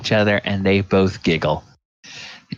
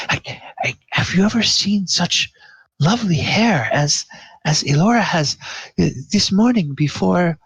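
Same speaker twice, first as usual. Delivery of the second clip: put-on higher, eager young queen gushing; giddy royal gushing over a friend's hair; excited queen; 9 semitones higher than usual. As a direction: bubble over with delight, pitched up.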